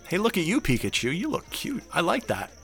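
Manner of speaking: high teasing flirt, soft; flirty. Acted. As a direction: tease him playfully. Host flirts playfully.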